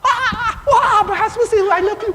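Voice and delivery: high, loud, squealing retold wife; excited screaming. Older wife phoning her pastor at midnight, squealing with joy. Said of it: "so she was screaming".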